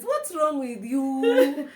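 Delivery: slow, loud, laughing mock rebuke; hammed-up slow telling-off; slow drawn-out scold. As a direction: stretch each word like a scolding. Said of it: "her message is".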